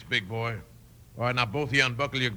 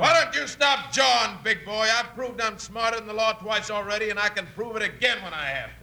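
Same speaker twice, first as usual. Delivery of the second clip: gloating taunt; 8 semitones higher than usual. Acted. Outlaw mocking the locked-up lawmen, sneering high taunt. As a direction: rub their noses in it.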